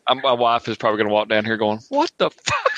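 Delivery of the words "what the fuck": high, far-carrying mock shout; mock yell. Wife yelling down the stairs at the noise. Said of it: "Since I'm yelling".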